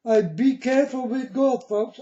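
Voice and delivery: usual speaking voice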